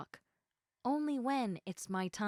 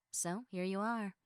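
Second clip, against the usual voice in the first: normal talking voice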